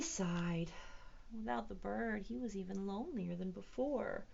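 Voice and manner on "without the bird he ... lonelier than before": ordinary talk, nothing marked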